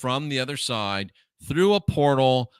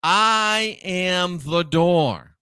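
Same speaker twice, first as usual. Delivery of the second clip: very slow, each word held long and firm; drawn-out, weighty scripture quote; emphatic declaration; 2 semitones higher, 4 dB louder, much slower than usual. Retold or imitated. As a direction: proclaim it slowly, demanding notice. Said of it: "take notice".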